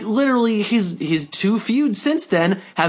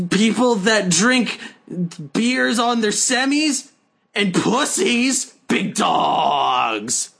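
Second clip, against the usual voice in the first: big dog voice; 3 semitones higher, slower than usual. Pretend. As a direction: drawl like a beer-swilling meathead. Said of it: "the big dog voice".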